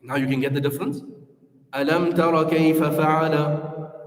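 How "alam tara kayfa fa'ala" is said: slow "Alam tara kayfa fa'ala", "fa'ala" set apart; careful "Alam tara kayfa fa'ala" with words separated; phrased by meaning. Shown as a demonstration. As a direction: pause before "fa'ala", unhurried. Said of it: "is one way".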